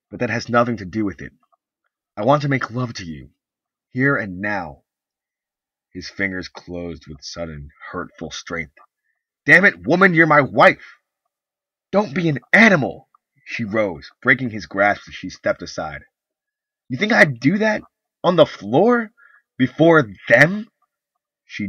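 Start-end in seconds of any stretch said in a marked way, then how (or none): 0.1-4.8 fast, louder insistence; insistent. Acted. Husband insists. 9.4-11.0 much louder, higher furious shout; angry shout. Acted. Husband shouts. 11.9-13.1 much louder, higher disgust; angry shout. Acted. Wife shouts back. 16.9-20.7 loud high indignant questions; appalled outrage; outraged. Acted. Wife outraged.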